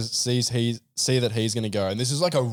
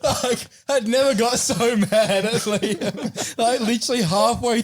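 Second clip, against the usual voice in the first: laughing through it; 9 semitones higher than usual. Real, not acted. Laughing while retelling the yoink.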